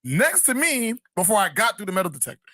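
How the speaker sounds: high, slow, stunned disbelief; incredulous. Retold or imitated. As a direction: drag it out in pure disbelief. Retelling his own disbelief.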